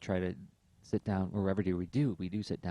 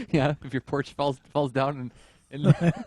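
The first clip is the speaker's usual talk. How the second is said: laughing; 6 semitones higher, 8 dB louder than usual. Real, not acted. Laughing joke completion.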